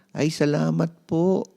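put-on high, slow relieved boy's voice; relieved child. Mark thanks the doctor, high and relieved. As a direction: sigh it out like a relieved boy.

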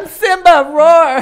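high, loud cartoon lion cub; hammed-up shout into a roar; Simba.

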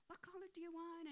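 high, soft, sweet offer; smiling sweetness. Acted. Repeating her kind offer to the lady, smiling sweetness. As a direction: ask it softly and high, with a smile. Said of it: "now I had a smile".

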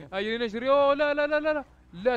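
loud, high panicked yelps; rising panic as the chase closes in; panicked shouting. Real, not acted. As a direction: shout in alarm while fleeing. Zombie chase, player flees in panic.

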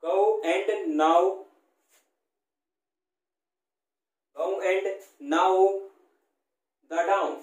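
go, now, down spaced out slowly, each word alone; drawing out the ow vowel; slow dictation. Shown as a demonstration. Teacher reads the example words slowly to show the vowel. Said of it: "ऊ की ध्वनि निकल रही".

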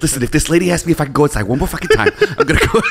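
fed-up actor impression, laughing through it; Mark Wahlberg. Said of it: "Do you think Mark Wahlberg's".

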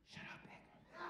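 high, hushed mock whisper; imitated sister, whispering; sister's whisper. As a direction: whisper it as his sister shushing him. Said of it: "his sister's going".

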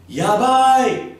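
very high, loud shriek; shrieked yabai. Shown as a demonstration. Teacher shows the inflection of yabai, shrieked. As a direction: squeal it at the top of your voice. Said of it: "it depends on how you say it".

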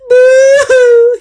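high, loud wailing boo-hoo; boo-hoo crying. A narrator's reading. Little beaver sobbing alone by the pond, loud high wail. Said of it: "he said".

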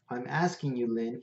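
usual speaking voice

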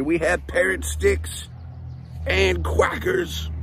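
lively, bright read-aloud storytelling; reading to children. Acted. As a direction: read it like story time for little kids. Reads picture book aloud.

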